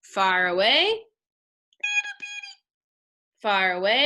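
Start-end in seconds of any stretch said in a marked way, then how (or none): 0.8-2.6 tiny squeaky voice. Shown as a demonstration. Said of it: "So say this with me".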